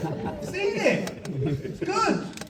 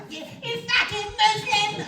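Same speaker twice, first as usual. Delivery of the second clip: high, mock-furious abuse; mock hate email. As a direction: shriek the insult like an angry troll. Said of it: "every day there's an email".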